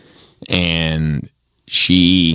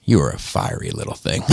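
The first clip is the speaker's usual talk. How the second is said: lower, softer fond grandma voice; 6 semitones lower, 5 dB quieter than usual. Retold or imitated. Grandma fondly teasing her grandson about his past, soft and low. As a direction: tenderly tease him.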